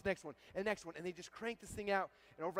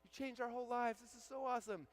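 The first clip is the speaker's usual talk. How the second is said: imitating gushing fans, higher-pitched; adoring fans; 6 semitones higher, 3 dB quieter than usual. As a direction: gush in a high mock-fan voice. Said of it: "they all love me".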